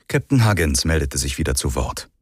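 ordinary delivery

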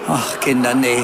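real laughter after a dirty joke; laughing. Real, not acted. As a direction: laugh it off at the crowd.

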